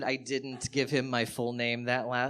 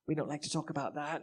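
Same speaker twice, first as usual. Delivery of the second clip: quick, softer mock-British deflection; put-on British accent; 6 dB quieter, faster than usual. Fake Brit dodging the lawyer's question, in a British accent. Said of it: "pulling out my British greatest hits".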